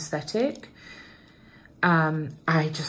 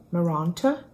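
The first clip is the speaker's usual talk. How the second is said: posh "Maranta" with a long, open "ah" in the middle; posh-accented "Maranta?" with a questioning lift; posh pronunciation. Shown as a demonstration. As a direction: say "Maranta" the posh way, as mar-AHN-ta, as if asking. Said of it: "That sounded very posh".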